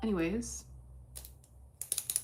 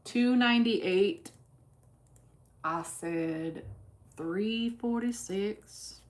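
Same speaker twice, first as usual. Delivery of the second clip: put-on slow, drawn-out numbers in a vague Southern accent; put-on Southern-style accent; 2 semitones higher, much slower than usual. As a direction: slip into an uncertain Southern drawl, stretching the words. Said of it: "what kind of accent that is".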